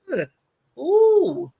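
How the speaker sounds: high, pained ugh-ooh groan; disgusted groan. Retold or imitated. Describing what still triggers her, high disgusted groan. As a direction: let out a squeamish shudder of a groan. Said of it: "trigger me to say".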